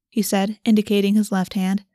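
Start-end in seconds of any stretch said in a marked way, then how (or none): none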